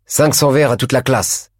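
high, angry outburst; teacher silencing the uproar, furious. A narrator's reading. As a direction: slam the punishment on the class. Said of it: "exclamé d'une voix furieuse".

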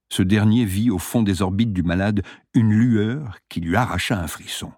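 plain reading voice

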